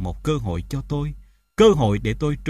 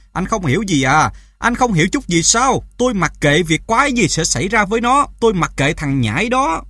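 loud, raised, contemptuous rant; exasperated anger; 6 semitones higher, 6 dB louder than usual. A narrator's reading. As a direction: hammer it home that you don't care. Mother rages at father. (gắt lên giận dữ)

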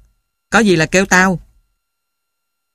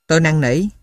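ordinary delivery

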